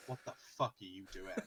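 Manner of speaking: low, hushed scolding; retold wife's reproach. Wife to gamer who threw the headset, hushed retold reproach. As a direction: keep the telling-off low and quiet.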